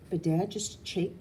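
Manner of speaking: plain reading voice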